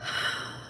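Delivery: long soft exhaling sigh; demo exhale. Shown as a demonstration. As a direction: breathe out slowly to show relief. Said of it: "real belonging feels like exhaling".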